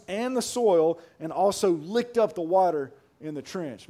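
preached scripture reading. A narrator's reading. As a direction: read the verse out with force.

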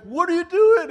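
high, loud playful scold; mock scolding. Jesus jokingly scolding Saul on the road, high and loud. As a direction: call him out like a buddy.

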